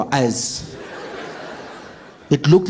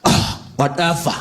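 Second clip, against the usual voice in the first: attempted eye-roll. Shown as a demonstration. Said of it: "Can you teach me".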